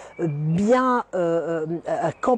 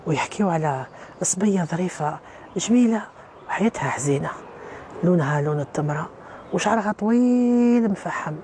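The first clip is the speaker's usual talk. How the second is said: measured Arabic tale-telling with pauses between phrases; recited folk tale, drawing out key words; traditional storytelling; 2 semitones lower, much faster than usual. Acted. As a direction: tell it like an old legend, phrase by phrase.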